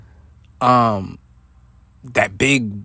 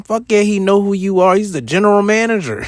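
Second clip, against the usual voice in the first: higher and louder, proud and emphatic; emphatic boast; 8 semitones higher, 6 dB louder than usual. Retold or imitated. The boss answering about himself, loud and boastful. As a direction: crow it with swagger. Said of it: "You know who I am?".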